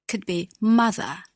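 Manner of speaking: ordinary delivery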